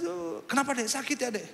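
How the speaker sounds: high-pitched, anxious retold questioning; worried concern. Brother asks his crying sister about pain, worried concern. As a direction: fuss over a hurting little sister.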